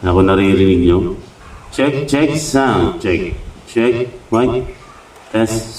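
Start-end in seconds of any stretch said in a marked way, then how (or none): 1.7-5.8 mic check with echoing delay. Shown as a demonstration. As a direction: sound check through a delay and repeat effect. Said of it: "Nagkakaroon ng echo yung ating speaker".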